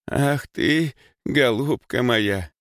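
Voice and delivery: high, slow, tender granny lament; баба Маша. A narrator's reading. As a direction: croon comfort to a crying girl, drawing it out. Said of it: "повторяла она, гладя Лёльку". (жалостливо причитая)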